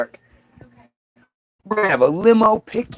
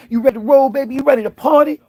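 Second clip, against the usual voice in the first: higher and louder, climbing to party; second ask, more pumped than the first; hype-man escalation; 7 semitones higher, 3 dB louder, faster than usual. Retold or imitated. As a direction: push it up like a rising hype chant. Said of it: "he wants to just".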